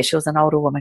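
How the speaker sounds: ordinary talk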